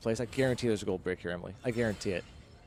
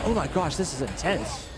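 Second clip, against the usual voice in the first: a higher, excited oh my gosh; excited gasp; 5 semitones higher, slower than usual. Real, not acted. Streamer in awe of an intense boss, excited. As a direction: marvel at the boss fight.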